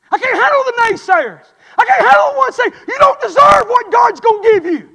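desperate prayer. Retold or imitated. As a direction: cry out to the Lord at full force. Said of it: "got on these old knees and prayed".

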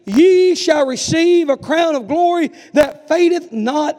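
loud, slow, ringing scripture reading; preaching scripture. Acted. Preacher to congregation, booming slow scripture reading. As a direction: proclaim it slowly to the congregation.

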